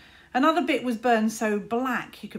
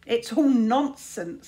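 smug; 2 semitones higher, 8 dB louder, slower than usual. A narrator's reading. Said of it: "smiled Luther".

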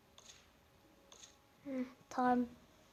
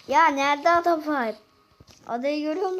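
loud exclamation; 4 semitones higher, 11 dB louder than usual. Real, not acted. Looking for island, alarmed.

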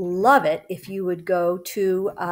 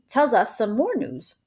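ordinary delivery, nothing marked

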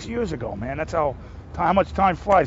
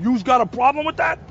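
a hint of a tough mob guy, higher and a bit louder; mock mob guy; 6 semitones higher, 3 dB louder than usual. Actor lightly voicing his mob character's dare to the interviewer. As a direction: toss it off like a wiseguy.

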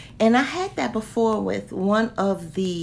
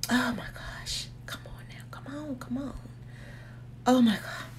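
awed whisper; 3 semitones higher, 7 dB quieter than usual. Real, not acted. Awed by the packaging.

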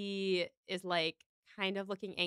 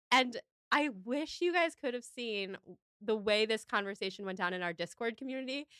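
ordinary talk, nothing marked

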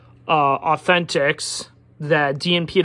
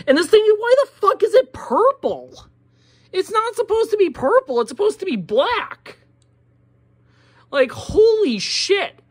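high-pitched, furious ranting at the toy company; venting real fury, voice pitched way up; angry rant; 7 semitones higher than usual. Real, not acted. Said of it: "A little cool off moment".

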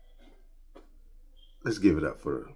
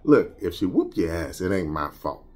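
ordinary talk, nothing marked

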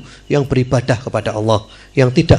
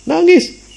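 mock wailing cry. Camel crying to the Prophet.

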